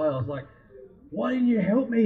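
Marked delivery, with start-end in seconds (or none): 1.1-2.1 higher, louder, accusing yell; retold angry yell. After nearly drowning, yelling at the man with the spotlight, angry. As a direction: shout it at the man who didn't help. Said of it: "I actually got angry at the guy".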